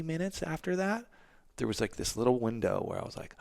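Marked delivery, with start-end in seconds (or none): 3.4-3.4 sharp gasp of surprise; amazed gasp. Retold or imitated. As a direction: gasp at the sudden relief. Feeling good after training.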